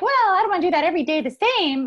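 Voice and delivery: fast, high, loud mock-complaint; mock the urge to mix it up, fast and whiny; whiny woman. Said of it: "We're like".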